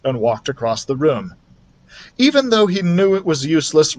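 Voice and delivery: ordinary delivery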